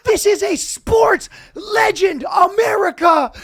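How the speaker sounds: announcer hyping a dropped hot dog, loud and high; over-the-top announcer. Retold or imitated. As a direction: bellow it like a ring announcer. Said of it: "And they go".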